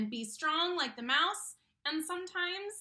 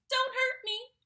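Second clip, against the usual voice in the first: high, louder frightened plea; whimpering; 11 semitones higher, 8 dB louder than usual. Acted. The lion begs the little mouse, high frightened whimper. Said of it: "he whimpered".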